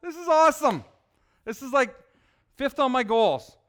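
thrilled schoolgirl gushing over seeing an angel; excited Mary. Acted. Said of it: "Now, is she excited?".

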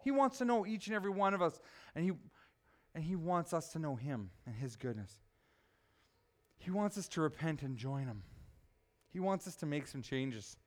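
soft, low pastoral appeal; hushed preaching. Real, not acted. Preacher telling the congregation that he wants us to know him, hushed. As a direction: drop low and quiet, pleading gently with the congregation.

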